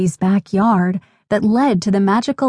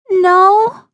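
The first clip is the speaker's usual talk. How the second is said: put-on high, louder little-girl whine; muffled wail; 11 semitones higher, 5 dB louder than usual. A narrator's reading. Hurt little sister answers from the trees, high muffled wail. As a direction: wail it back as a hurt child. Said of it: "came the muffled reply".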